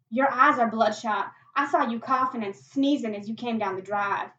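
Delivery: loud, forceful confrontation; loud accusation. Acted. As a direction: accuse him at full voice. Acted accusation at gunpoint.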